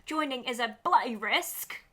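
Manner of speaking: hammed-up mock British accent; put-on British accent, relishing the line. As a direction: read it out in a playful British accent. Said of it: "Let me get my British accent out".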